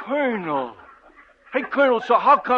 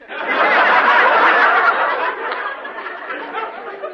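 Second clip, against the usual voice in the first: breathy unvoiced laugh, rising; a long wheezing chuckle at the joke; 9 semitones higher, 4 dB louder than usual. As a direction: laugh at your own gag. [name] laughs at own joke.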